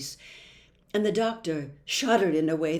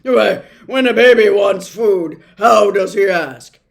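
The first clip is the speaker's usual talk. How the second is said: very loud quizzing; roaring doctor; 16 dB louder than usual. A narrator's reading. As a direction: bellow the question like a challenge. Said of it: "the doctor roared".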